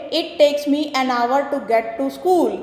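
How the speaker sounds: slow, careful sentence reading; slow classroom read-aloud; slow dictation. Shown as a demonstration. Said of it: "तो यहाँ पे".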